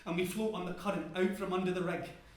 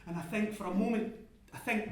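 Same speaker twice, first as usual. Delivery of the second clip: usual speaking voice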